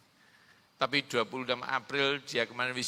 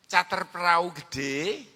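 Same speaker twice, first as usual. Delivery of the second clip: ordinary talk, nothing marked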